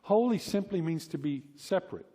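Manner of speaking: normal talking voice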